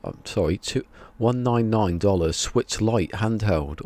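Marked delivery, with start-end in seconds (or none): none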